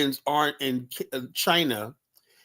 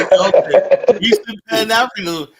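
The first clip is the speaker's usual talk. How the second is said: higher and louder, sending up his parting slogan; loud, raised mock farewell; mocking his exit line; 6 semitones higher, 10 dB louder than usual. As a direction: parrot him on the way out the door. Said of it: "goes say".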